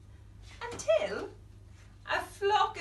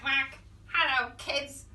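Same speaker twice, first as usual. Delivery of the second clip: ordinary delivery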